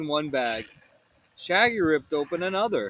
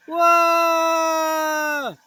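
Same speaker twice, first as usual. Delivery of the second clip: startled whoa; 11 semitones higher, 7 dB louder than usual. Acted. Sliding off ski lift.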